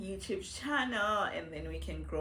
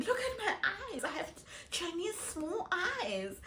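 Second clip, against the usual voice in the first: put-on childhood joke quote; 11 semitones higher, 5 dB quieter than usual. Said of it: "I was like".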